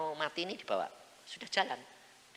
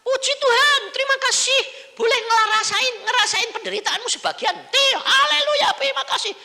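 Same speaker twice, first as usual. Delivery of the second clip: very high, screaming praise; shouted thanksgiving; 14 dB louder than usual. Retold or imitated. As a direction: yell hammed-up thanks to God. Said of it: "wis bar mau gembor-gembor". (seruan syukur yang melengking)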